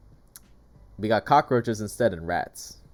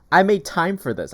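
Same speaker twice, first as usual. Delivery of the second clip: higher, louder indignant line; retold angry complaint; 8 semitones higher, 7 dB louder than usual. Offended tenant complaining loudly to the broker's rep.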